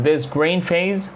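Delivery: "This green phase?" slow, lifting high on "phase"; rising question. Shown as a demonstration. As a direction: check which one, voice climbing. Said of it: "فالتوناليتي بتاعتك بتختلف".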